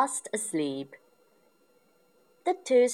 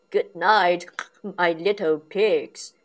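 loud grunty dad good night; Daddy Pig's grunt; 2 semitones higher, 7 dB louder than usual. A narrator's reading. Dad grunts good night to his little pigs, loud. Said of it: "grunts Daddy Pig".

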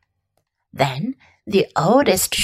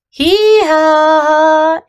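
louder, higher bleat stretched long; sheep bleating in chorus; 6 semitones higher, 6 dB louder than usual. Acted. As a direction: bray it out like a flock. Sheep answer all together.

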